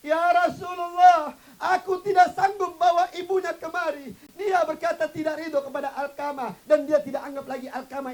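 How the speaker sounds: loud, high, tearful cry; crying plea. Acted. Umar returning to the Prophet in tears, crying. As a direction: wail it through tears. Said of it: "kembali kepada Rasulullah sambil menangis".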